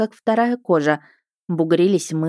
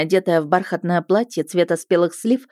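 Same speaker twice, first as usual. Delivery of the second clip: plain reading voice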